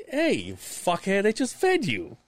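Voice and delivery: mock exasperated rant. Scolding the crew who just got fed, high exasperated rant. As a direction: rant at the ungrateful crew, laughing.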